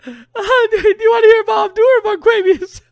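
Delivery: high-pitched, loud laughing squeal; helpless high laughter carrying the words; cracking up. Real, not acted. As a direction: squeal the question through laughter. Cracking up over the name.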